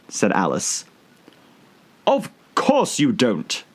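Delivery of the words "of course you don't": contemptuous. A narrator's reading. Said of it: "tossing his head contemptuously".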